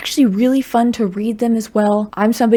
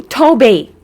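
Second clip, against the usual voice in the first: sharp call, louder, to stop the pet; 6 dB louder than usual. Real, not acted. Owner scolding cat for messing with the light, loud call. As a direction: call the cat's name to stop him.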